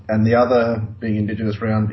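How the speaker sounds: normal talking voice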